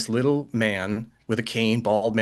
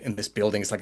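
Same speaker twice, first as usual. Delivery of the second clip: ordinary delivery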